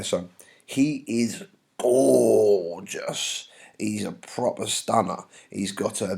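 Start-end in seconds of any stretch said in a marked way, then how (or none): 1.8-3.5 "gorgeous" hugely stretched out; gushing emphasis. Acted. Nurse gushing about Romeo's looks, "gorgeous" drawn right out. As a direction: swoon slowly through a long first vowel in "gorgeous".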